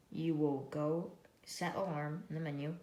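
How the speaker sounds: usual speaking voice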